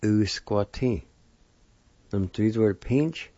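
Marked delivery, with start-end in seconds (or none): 0.0-1.0 Usquatin drawn out slowly, each sound clear; slow Usquatin down for learners; slower demo. Shown as a demonstration. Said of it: "It's pronounced like this".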